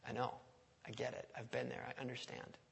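hushed word of comfort; gentle reassurance. Acted. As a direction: reassure them quietly, unhurried. Jesus comforts someone who struggles.

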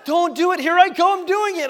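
high, loud mock panic building; losing the fight with himself, laughing; hammed-up inner voice. Dad's inner voice losing his temper in traffic, hammed-up loud. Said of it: "in your own mind, you're like".

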